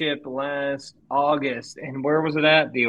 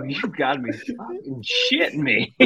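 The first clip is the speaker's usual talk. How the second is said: incredulous; 10 semitones higher, 4 dB quieter than usual. Retold or imitated. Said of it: "And I just go".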